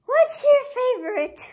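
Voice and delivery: loud singsong interviewer; loud question. Real, not acted. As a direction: belt out the question, pitch falling across it. Calls out question loudly.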